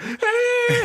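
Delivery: high-pitched bawling "ah"; hammed-up childish cry; mock crying wail. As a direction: wail like a kid running off in tears. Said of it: "just run away crying".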